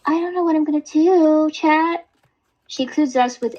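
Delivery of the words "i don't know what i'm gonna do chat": pitched way up, a girly whiny mock voice; squeaky mock-upset girl; high-pitched imitation. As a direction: imitate her, high and breathless with woe. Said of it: "your higher pitched voice imitating people".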